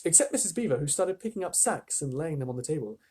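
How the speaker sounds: usual speaking voice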